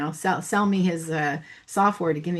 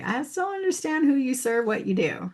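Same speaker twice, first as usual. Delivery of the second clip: a higher, puzzled mock voice of someone lost; confused listener; 6 semitones higher than usual. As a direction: play the baffled prospect scratching their head.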